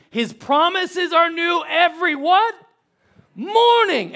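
high, loud preacher's call, slow; call and response. Real, not acted. Pastor prompting the church to finish the verse, ringing out. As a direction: draw the congregation into the answer.

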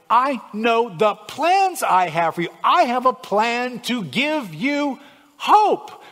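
high, loud, full-force preaching; impassioned preaching. Real, not acted. Preacher urging listeners to hope in God, full-force preaching. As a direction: proclaim it with big stretched key words.

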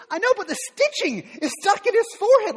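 exasperated, pointing out the obvious; wife scolding over the baseball stitches. Retold or imitated. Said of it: "She goes".

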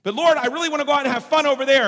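loud, high, fast objection; acted pleading, rushed and high-pitched; whining protest to God. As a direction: beg the Lord like an eager kid. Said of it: "when I go to God".

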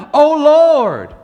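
loud, high invocation; crying out. Retold or imitated. Psalmist opens the psalm to God, loud cry. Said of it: "the way that psalm started out".

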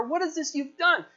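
high-pitched, astonished rebuke; rebuking. Acted. Sailors confronting runaway Jonah, rebuking. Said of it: "unbelievers rebuking the believer".

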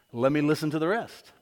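ordinary delivery

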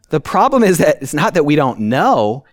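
ordinary talk, nothing marked

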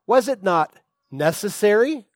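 quoting Jesus. Retold or imitated. Pastor voicing Jesus to confused disciples, earnest question. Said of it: "Listen to what Jesus says".

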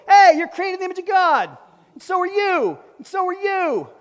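loud, high greeting to passersby; excited shout to strangers. Pretend. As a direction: call out joyfully across the store. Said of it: "just go".

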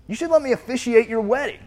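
high, bright-eyed put-on voice; eager offer. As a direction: pitch it up with eager excitement.

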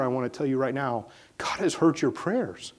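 ordinary talk

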